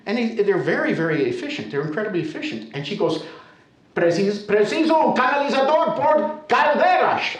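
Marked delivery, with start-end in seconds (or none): 3.9-7.4 loud, high mock tirade; hammed-up indignant outburst; exasperated scolding. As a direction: imitate an irate Portuguese neighbour. Upset neighbour scolds him over wrong tradesman.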